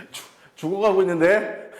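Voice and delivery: loud, high teasing through laughter; laughing. Real, not acted. Teacher teasing a worn-out student, laughing.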